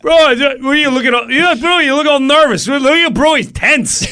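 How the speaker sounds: loud, high teasing bro voice; hammed-up loud, high bro ribbing; teasing stagehand. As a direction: needle your nervous buddy loudly.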